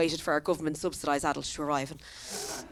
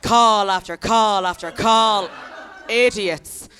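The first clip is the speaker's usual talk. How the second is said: exasperated cop; 7 dB louder, much slower than usual. Retold or imitated. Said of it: "He was like".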